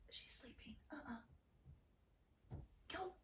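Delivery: soft whispered thoughts; hushed whisper to herself; inner whisper. A narrator's reading. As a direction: whisper as if not to wake her.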